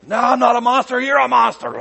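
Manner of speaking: high, loud hammed-up denial turned accusation; a church member shouting back in the feud, hammed-up and loud; mock accusing quarrel. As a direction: throw the blame back, over the top. Mock quarrel between church camps.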